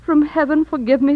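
usual speaking voice

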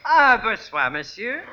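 put-on French accent, polite greeting. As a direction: greet him as a phony French professor. Phony French professor greets his pupil's husband.

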